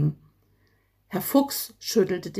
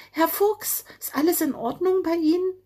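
high, quick little bird voice, full of concern; a tiny bird fussing over a fallen friend; much higher, fast and caring; 9 semitones higher, faster than usual. A narrator's reading. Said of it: "fragte der kleine blaue Vogel teilnahmsvoll".

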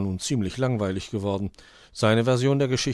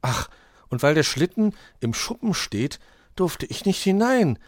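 high, loud cry of realisation; Weißohr exclaiming; 10 semitones higher, 6 dB louder than usual. A narrator's reading. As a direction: call it out as the penny drops. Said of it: "rief Weißohr".

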